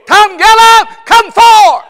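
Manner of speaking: high, shouted summons; God's call. Acted. Preacher voicing God calling him forth, a shouted summons. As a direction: call them up out of the grave.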